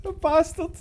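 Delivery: ordinary delivery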